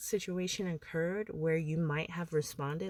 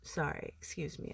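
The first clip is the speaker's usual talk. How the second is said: whispered apology, much softer; whispered aside; 8 dB quieter than usual. Real, not acted. Apologizing to viewers in a quick whisper. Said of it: "apologizing in aside".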